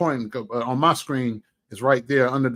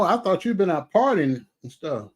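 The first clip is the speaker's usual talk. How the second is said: ordinary talk, nothing marked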